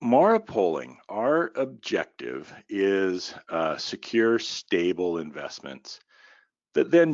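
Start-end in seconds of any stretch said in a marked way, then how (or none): none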